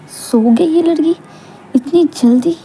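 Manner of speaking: amazed disbelief. Acted. Husband amazed she fell asleep.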